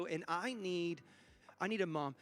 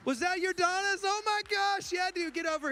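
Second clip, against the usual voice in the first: high, louder delighted exclamation; excited surprise; 10 semitones higher, 4 dB louder than usual. Real, not acted. Pastor spotting the raffle winner, high and thrilled. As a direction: burst out in happy disbelief.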